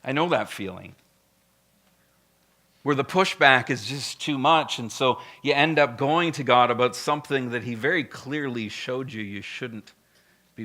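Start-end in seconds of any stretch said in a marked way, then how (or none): none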